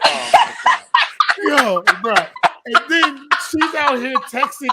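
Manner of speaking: high, loud, slow laughing gasp; laughing disbelief. Real, not acted. Laughing exclamation at the story.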